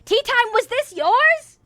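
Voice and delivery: high, loud, drawn-out question; shocked accusation. Real, not acted. Streamer finding the culprit in chat, shocked. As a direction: stretch it upward in disbelief. Discovers who sent the confession.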